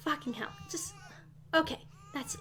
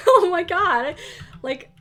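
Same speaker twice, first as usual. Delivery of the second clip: ordinary talk, nothing marked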